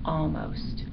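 very soft single word; quiet, private murmur; whispered. A narrator's reading. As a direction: breathe it out as a hushed hope. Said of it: "the good dog whispered".

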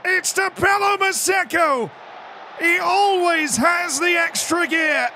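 celebrating a late goal; ecstatic shouting. Real, not acted. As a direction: scream the whole goal play out.

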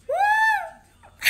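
loud high whoop breaking into laughter; whoop, then laugh it off. Real, not acted. Idol whooping with laughter to her fans.

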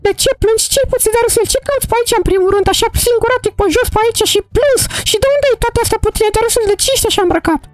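put-on high, worried little girl asking why he cries; a young girl pleading with a crying friend; girl character voice. Mara asks crying Darusel what's wrong, high worried voice.

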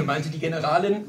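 ordinary delivery, nothing marked

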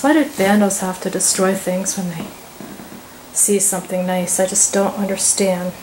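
ordinary delivery

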